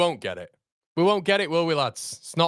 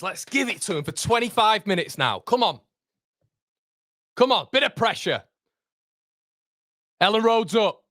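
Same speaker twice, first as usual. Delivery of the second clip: normal talking voice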